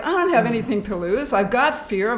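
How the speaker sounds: normal talking voice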